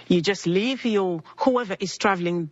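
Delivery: ordinary delivery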